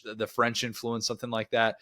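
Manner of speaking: ordinary talk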